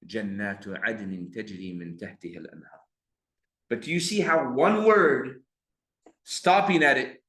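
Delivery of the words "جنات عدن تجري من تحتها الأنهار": جنات عدن تجري من تحتها الأنهار recited quickly and softly; a teacher softly reciting the resumed verse; softer recitation. Shown as a demonstration. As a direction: carry on quietly and quickly from جنات. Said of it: "Go back".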